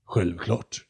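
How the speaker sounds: haughty, self-assured word; arrogant reply. Acted. The condor answers Jonathan with lofty arrogance. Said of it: "svarade kondoren arrogant".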